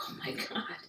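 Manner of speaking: a hushed, amazed whisper of Oh my God. Retold or imitated. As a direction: breathe it out in awe. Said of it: "I'm like".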